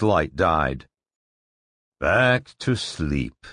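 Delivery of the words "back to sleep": grim. Acted. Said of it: "Barris thought grimly".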